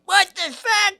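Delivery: very high squawking mock voice; mocking high-pitched outrage; imitated drunk heckler. Drunk woman after he guesses her plate, high mock outrage. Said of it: "And then she's like".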